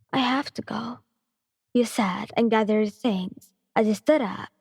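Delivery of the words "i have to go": whispered goodbye; unvoiced whisper, falling at the end. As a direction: say it with only breath. Girlfriend leaving, quiet.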